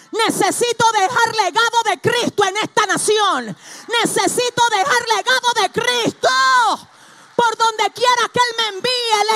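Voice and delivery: loud, high, driving sermon voice; a fiery preacher rousing the congregation; full-force preaching. Acted. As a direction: preach it hard to the whole room.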